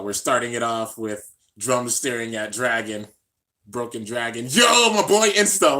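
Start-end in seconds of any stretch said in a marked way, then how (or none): 4.5-5.8 louder, higher hyped hello; excited greeting. Real, not acted. Streamer greeting a viewer, loud and excited. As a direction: call out to your buddy.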